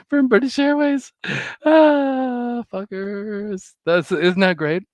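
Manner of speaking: high, loud delighted laugh-talk; gleeful. Real, not acted. Telling viewers about the refund, gleeful and high-pitched. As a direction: crow with glee at the refund.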